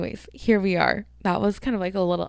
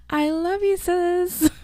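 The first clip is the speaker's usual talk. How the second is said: sweet high sing-song shout-out; high squeaky love-you; 11 semitones higher, much slower than usual. Pretend. Podcaster cooing a high sweet hello to her sister.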